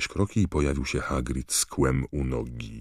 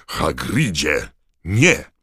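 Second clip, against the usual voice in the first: shout; 3 semitones higher, 8 dB louder, much slower than usual. A narrator's reading. Dumbledore stopping Hagrid from choking Karkarov, shout. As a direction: shout to stop him. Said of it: "krzyknął Dumbledore".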